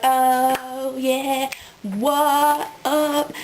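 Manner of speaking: hint of a hammed-up party bro; cool party dude. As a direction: play a vampire trying to act cool on the dance floor. Vampires start dancing at party.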